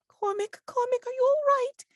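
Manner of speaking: very high, anxious falsetto; high-pitched worried tenderness; tender shrill voice. Acted. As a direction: fuss over a hurt loved one in a high voice. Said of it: "like, tenderly".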